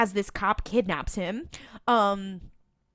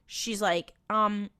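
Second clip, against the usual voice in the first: ordinary delivery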